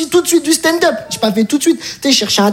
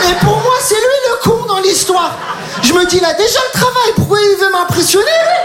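gleefully convinced he's caught a bluffer; excited inner voice; 7 semitones higher than usual. Retold or imitated. Thinking the recruiter bluffs, excited high voice.

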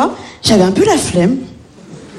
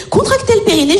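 imitated midwife instruction; 9 semitones higher than usual. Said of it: "ma sage-femme me fait".

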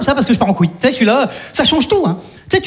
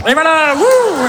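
mock whoosh; 5 semitones higher, much slower than usual. Shown as a demonstration.

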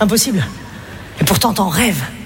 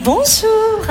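high, bright chirpy bonjour, much higher; sugary model-mum hello; 11 semitones higher than usual. Pretend. A mum at the park hamming up a sweet greeting to the other parents. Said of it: "maman modèle qui s'implique".

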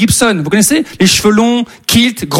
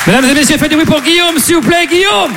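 high, rousing stage announcer call; appel au public; 6 semitones higher than usual. Real, not acted. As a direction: whip up the crowd's applause. L'humoriste fait applaudir l'animateur. (voix haute de présentateur de scène)

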